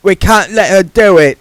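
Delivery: high, lightly voiced young woman; Margaret's voice. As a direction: lift the voice for the young witch.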